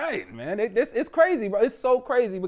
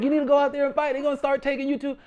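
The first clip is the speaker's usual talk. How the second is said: higher, urging voice, faster at the end; higher mock urging; mock pleading allies; 8 semitones higher, faster than usual. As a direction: play the well-meaning friend egging him on. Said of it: "They're like".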